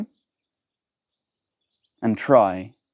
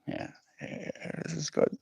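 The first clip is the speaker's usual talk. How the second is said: breathy whisper, far softer, to himself; whispered aside; 6 semitones higher, 13 dB quieter than usual. Real, not acted. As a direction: whisper it under your breath as if pleased.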